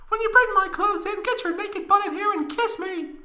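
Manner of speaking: put-on very high girl voice asking; Tifa falsetto. Tifa asking Cloud for her clothes, high falsetto. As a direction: ask sweetly in falsetto.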